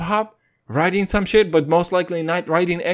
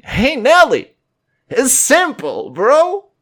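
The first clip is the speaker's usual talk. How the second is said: higher, louder, slow taunting call; mock advice to Nelly; 8 semitones higher, 5 dB louder, slower than usual. Podcaster calling out to Nelly about shaving, loud mocking taunt. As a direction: call out to Nelly like an exasperated buddy.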